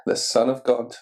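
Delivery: plain reading voice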